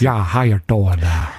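slow, low hammed-up American drawl; bad American accent. Shown as a demonstration. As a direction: stretch it into a mock American accent. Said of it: "Is that your American accent".

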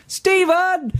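high, stretched call from a crowd; mock shout. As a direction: call the name out across an auditorium. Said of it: "a lone voice ... shouted".

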